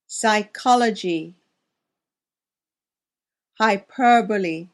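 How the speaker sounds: each word said alone and clearly, with long pauses; careful teacher demo of single words; pronunciation drill. Shown as a demonstration. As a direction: model every word for learners to repeat. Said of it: "Listen and repeat".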